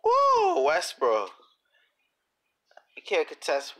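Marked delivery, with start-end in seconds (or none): 0.0-0.6 high, loud excited whoop. Real, not acted. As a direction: yell it out with hype. Hype yell during play.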